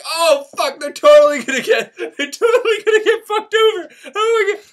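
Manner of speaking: high, laughing cackle of glee; God laughing high at the trouble ahead; gleeful God. Retold or imitated. God in heaven watching humans, laughing high. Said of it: "God is up in heaven like".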